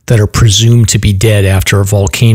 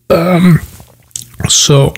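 coughing, throat still catching; choking on a drink; 7 semitones higher than usual. Real, not acted. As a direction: talk through a coughing fit. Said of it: "choking on my beer here".

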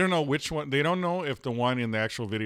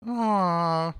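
cute cooing noise; 6 semitones higher, 4 dB louder than usual. Retold or imitated. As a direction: coo at something adorable. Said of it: "There's that noise, that voice you did".

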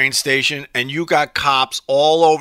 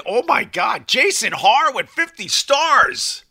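high, drawn-out thrilled exclamation; excited; 9 semitones higher, slower than usual. Real, not acted. Livestreamer thanking a viewer for fifty stars, excited. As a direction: stretch the name out in high-pitched excitement. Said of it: "It's just exciting".

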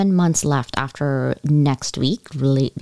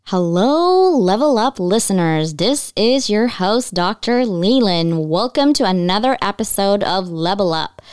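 podcast intro; 4 semitones higher than usual. Acted. Host welcoming Level Up listeners, upbeat announcer voice. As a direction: open the show like a radio host greeting fans.

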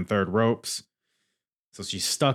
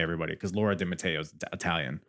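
normal talking voice

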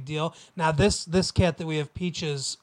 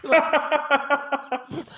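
laughing; 9 semitones higher, much slower than usual. Real, not acted. Laughing at own bluntness.

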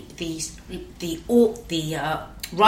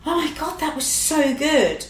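mum's gushing praise; 8 semitones higher than usual. Retold or imitated. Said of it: "she went".

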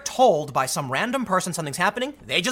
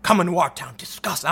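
lower, dramatic put-on voice; mock him lying in bed, aghast; hammed-up disgust; mocking Sean King; 4 semitones lower than usual. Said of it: "and he's like".

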